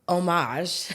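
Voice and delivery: "homage" as oh-MAHZH, silent h; French-style homage. Real, not acted. Trying out the French pronunciation.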